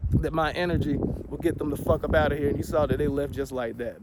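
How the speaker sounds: usual speaking voice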